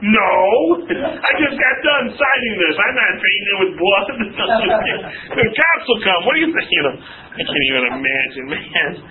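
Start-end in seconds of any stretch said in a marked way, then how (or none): 0.1-4.1 high, protesting mock outrage; indignant refusal. Homeowner refusing blood on his house, mock-indignant. 5.3-6.7 high-pitched, mock-alarmed objection; incredulous protest. Homeowner warning his neighbor about police, high and incredulous.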